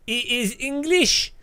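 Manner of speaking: high, loud mock French cop; hammed-up French gendarme pointing him out; mock French cop spotting the Englishman; French policeman.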